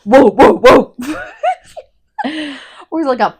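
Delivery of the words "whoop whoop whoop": loud mock hound woofs; loud playful barking, breaking into laughter; imitated dog bark. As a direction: woof on request, big and booming. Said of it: "you do it again?".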